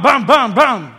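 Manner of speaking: normal talking voice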